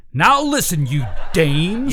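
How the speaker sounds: gruff tough-guy scolding; angry dressing down. Pretend. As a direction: bark it at the women. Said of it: "Then I'll say".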